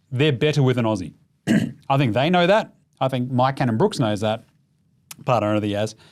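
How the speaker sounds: ordinary talk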